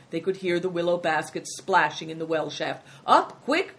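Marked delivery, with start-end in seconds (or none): 3.1-3.8 high, loud urging; quick raised-voice command; cried out. A narrator's reading. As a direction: call out briskly to hoist away. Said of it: "cried the innkeeper".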